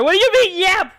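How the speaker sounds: high, loud indignant yell. Real, not acted. Streamer yelling at chat's claim, indignant shout. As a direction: shout it back in disbelief.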